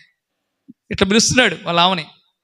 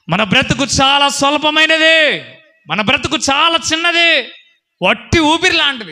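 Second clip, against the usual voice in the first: preaching shout; 6 semitones higher, 4 dB louder than usual. Real, not acted. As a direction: thunder it at the congregation. Preacher exhorting the congregation.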